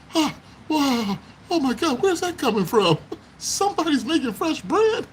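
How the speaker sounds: high, delighted gasping wonder; pretend amazement. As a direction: play a camper thrilled by the smell. Imagined campers smelling bread.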